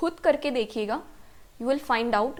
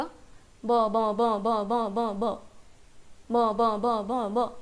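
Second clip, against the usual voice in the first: "म" rattled off fast until it sounds like "ब"; rapid, abrupt run of "ब, ब, ब"; fast repetition; 3 semitones lower, much slower than usual. Shown as a demonstration. Teacher shows how quick M turns into B, fast repeated "ब". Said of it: "अगर आप म को fast बोलोगे".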